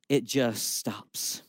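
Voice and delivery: low, soft and slow, letting it die; deadpan drop. Acted. Pastor telling the church how talk dies, slow and low. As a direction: let the words fall flat and fade. Conversation dying after "I'm a pastor.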